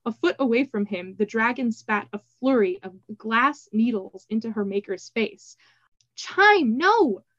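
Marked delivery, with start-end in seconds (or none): 6.2-7.2 high, loud call to stop; alarmed cry. A narrator's reading. Triss to her attacking dragon, crying out. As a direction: cry out to halt a pet mid-attack. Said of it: "cried Triss".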